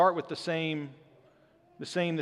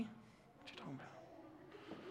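breathless whisper, slow and puzzled; whispered aside; 5 semitones higher, 25 dB quieter, slower than usual. Retold or imitated. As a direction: mutter it under your breath, baffled. Dad's baffled aside to kid.

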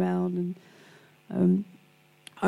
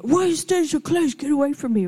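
high-pitched mock agitation; acted hostile protest in a raised pitch; hostile imitation; 6 semitones higher than usual. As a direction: imitate an agitated patient pushing someone away. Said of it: "she did that with me".

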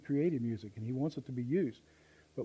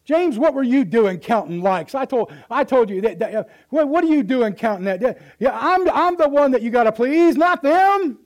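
angry Jesus; 10 semitones higher, 14 dB louder than usual. Acted. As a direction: rebuke the disciple with rising fury. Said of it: "He's ticked".

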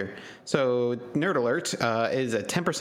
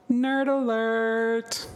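high, slow announcer call of Nerd Alert; 12 semitones higher, much slower than usual. Acted. Announcing the beer name.

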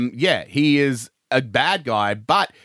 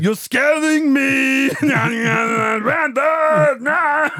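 high, drawn-out hammed-up shout; mock terrified wailing; 11 semitones higher, 3 dB louder, much slower than usual. Podcaster as Arnie getting scared by Randall, mock wailing. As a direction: wail it out in put-on terror.

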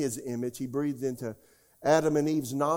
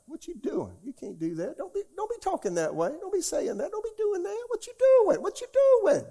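nagging inner voice; 3 dB louder than usual. Retold or imitated. Preacher voicing the scratch inside after salvation, high nagging scold. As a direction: voice of conscience climbing much higher and louder.

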